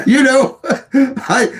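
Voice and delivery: high-pitched laughing words. Real, not acted. Admitting he might have to move to Texas, laughing. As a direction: talk right through the laugh.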